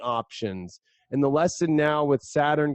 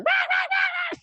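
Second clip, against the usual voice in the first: shrill shouted ape cries; caged monkey; faster than usual. Retold or imitated. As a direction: screech like a trapped monkey. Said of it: "the monkey's kinda stuck in the cage and going".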